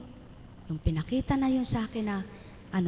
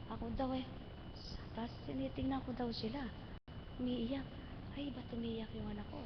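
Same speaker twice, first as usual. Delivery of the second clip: much softer, near-whisper telling; hushed; 13 dB quieter than usual. Retold or imitated. Seeing her crying child at her own burial, very soft. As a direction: hush it as if in a dream.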